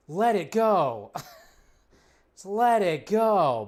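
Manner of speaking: telling fans slowly to let it go; slow drawn-out plea. Real, not acted. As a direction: drag it out, begging them to drop it. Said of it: "pleading to fans".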